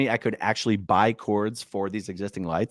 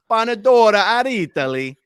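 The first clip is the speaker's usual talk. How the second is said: mock Italian flourish; 9 semitones higher, 7 dB louder than usual. Exaggerated repeat of name.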